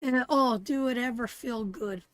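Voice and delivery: ordinary talk, nothing marked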